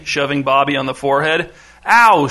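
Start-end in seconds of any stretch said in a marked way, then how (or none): 1.9-2.3 sharp loud yelp of pain; a boy yelping out loud when shoved; shouted ow. Acted. Shoved boy shouting at his brother, loud yelp. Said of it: "shouted Bobby".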